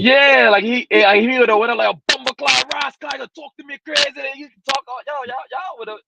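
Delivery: excited call-out about going off. Real, not acted. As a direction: hype the crowd as if the rant just landed. Hyped about Jamaican rant.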